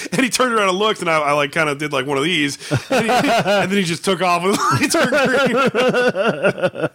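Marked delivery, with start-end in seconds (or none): 2.9-6.9 words broken up by laughter, slow; can't stop laughing while telling it; laughing through it. Real, not acted. Telling the punchline to his podcast partner, laughing.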